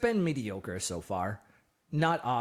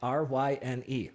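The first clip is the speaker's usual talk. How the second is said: ordinary talk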